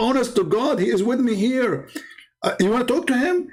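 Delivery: high, insistent imitation of a thrilled husband; high-pitched, hurried mock pleading; excited husband. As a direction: urge your disbelieving wife in great excitement. Acted husband urging wife on phone.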